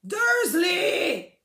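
giant's roar at Dursley; roaring shout. A narrator's reading. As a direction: bellow the name in fury. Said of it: "zaburácel".

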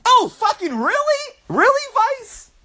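high, slow, mocking disbelief; sarcastic. Real, not acted. Scoffing at a Vice headline, high sarcastic drawl. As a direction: drag it out, way up high, sneering.

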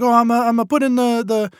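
high hesitant mock Italian accent; Italian grandma accent. Nonna explaining her gnocchi to grandson, Italian accent. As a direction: stumble like an old Italian grandma. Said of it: "sorry, my accent is terrible".

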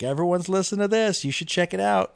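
high, chirpy sales pitch; a hint of an eager advertiser; mock ad voice. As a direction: play the cheerful app pushing a song. Mock Spotify recommendation voice.

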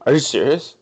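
surprised. Real, not acted. Stunned by three homers news.

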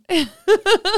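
loud laugh. Real, not acted. Laughing at scared coworkers.

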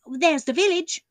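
driver pointing out. Acted. The driver points out the village to his passengers, calling it out. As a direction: point it out to the gentlemen.

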